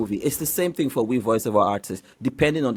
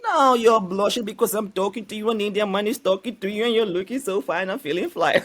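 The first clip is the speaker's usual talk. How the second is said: hammed-up Indian accent, pitched higher; Indian man impression; 9 semitones higher than usual. Shown as a demonstration. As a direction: play a flirty Indian man with a thick Indian accent. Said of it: "Please, can you talk like an Indian person".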